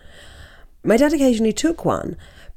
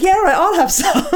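imitating eager strangers; 11 semitones higher than usual. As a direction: bounce it up high like a keen stranger. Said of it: "They were like".

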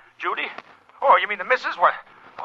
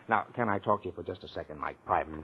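much softer, lower, confidential; hushed aside; 8 semitones lower, 10 dB quieter than usual. Acted. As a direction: draw him aside quietly. Takes Mike aside from bride.

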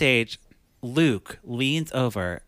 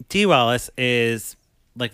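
usual speaking voice

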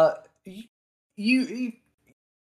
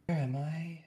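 dazed whisper; 3 semitones higher, 12 dB quieter than usual. Acted. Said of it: "in Dwarven".

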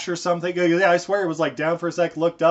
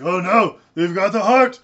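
hammed-up hero panic, higher and louder; mock dramatic alarm; 6 semitones higher, 7 dB louder than usual. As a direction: play the hero suddenly realising, over the top. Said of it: "So he's like".

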